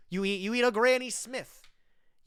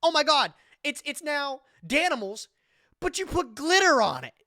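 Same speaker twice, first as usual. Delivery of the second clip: higher, louder mock ad excitement; mock hype; 7 semitones higher, 6 dB louder than usual. Mocking Danimals' gimmicks to viewers, hyped mock ad voice. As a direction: sell it like a kids' commercial.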